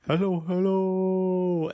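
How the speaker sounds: slow, drawn-out echo of a call. Acted. Empty house answering the kids, slow hollow echo. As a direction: imitate an echo bouncing back, stretched out. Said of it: "echoed back".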